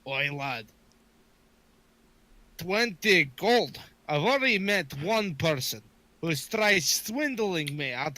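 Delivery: put-on drawn-out, haggling Scottish accent; put-on Scottish brogue, slow and pointed; indignant Scottish dwarf. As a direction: balk at the price like a canny Scot.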